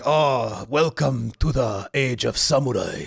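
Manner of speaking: slow, lightly put-on Japanese accent; light imitation of a Japanese accent, drawn out; faked Japanese accent. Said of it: "I faked the Japanese accent at the start".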